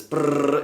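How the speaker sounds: longer rolled "brrr" trill; sustained buzz. Shown as a demonstration. Trainer mimics holding the button down, sustained trilled buzz. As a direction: imitate a continuous squeezing buzz. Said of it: "it's squeezing the muscle".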